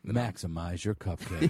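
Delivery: low, slow commercial tagline; slow and sexy. Pretend. Co-host adding a slogan to a spoof ad, slow and sexy. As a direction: deliver a mock ad slogan, drawn out. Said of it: "Slow and sexy".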